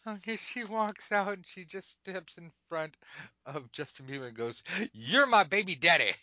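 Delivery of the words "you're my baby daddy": loud, higher mock accusation; accusing shout. Stripper loudly accusing [name] of fatherhood. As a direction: call him out in front of everyone.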